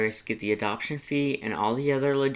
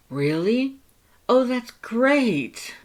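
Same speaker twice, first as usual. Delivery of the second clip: high, loud, slow elated cry; bursting with relief; overjoyed; 14 semitones higher, 7 dB louder, much slower than usual. Acted.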